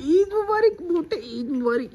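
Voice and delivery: high playful squeal of Eid wishes; mock squeaky greeting. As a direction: squeak the greeting like teasing a friend. (चिढ़ाते हुए ऊँची पतली आवाज़ में ईद मुबारक)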